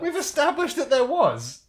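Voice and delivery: high, slow sarcastic quip. Acted. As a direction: say it with a dry sneer. Mocking the repeated phrase.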